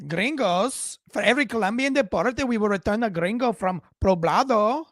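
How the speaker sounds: loud, high, lilting mock Colombian accent; Miss Petro. Host replaying the tweet for fun, hammed-up Colombian accent. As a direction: ham up a Colombian accent, big and singsong. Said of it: "I'm using her accent to make it fun for you".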